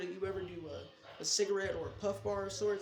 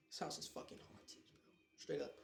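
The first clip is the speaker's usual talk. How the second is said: hushed whisper; 3 semitones lower, 12 dB quieter than usual. Real, not acted. Telling viewers the house is haunted, in a hushed whisper. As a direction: whisper it, voice dropped right down.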